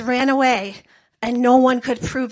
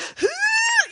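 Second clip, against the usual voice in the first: gasp. Pretend. Gory scene, audience reaction.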